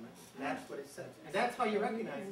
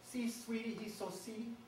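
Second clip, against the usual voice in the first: high mnemonic; 4 semitones higher, 5 dB quieter, slower than usual. Shown as a demonstration. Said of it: "I invented a mnemonic for this".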